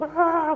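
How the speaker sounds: high, loud mock-ranting "waah"; angry outburst noise. Speaker imitating himself raging at God, mock angry yell. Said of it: "제가 막 화가 나가지고".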